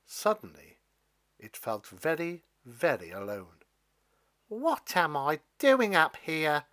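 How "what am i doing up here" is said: sad. Acted. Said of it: "it thought sadly".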